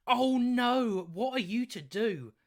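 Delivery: exaggerated fake helplessness; mock dismay. Vlogger ridiculing a complaining commenter, in mock dismay.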